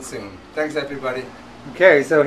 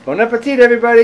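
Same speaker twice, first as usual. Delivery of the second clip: loud cheerful call; 7 semitones higher, 8 dB louder, much faster than usual. Real, not acted. Cheerful sign-off to viewers.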